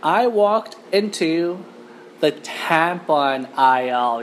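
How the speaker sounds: slow, drawn-out suspense; dramatic slow reveal. Real, not acted. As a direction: stretch it out for the big reveal. Suspenseful reveal after guessing game.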